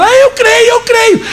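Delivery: high, wailing mimicry of a woman; mock tearful, desperate agreement; crying profession. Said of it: "começou a chorar". (imitando mulher chorando, voz aguda)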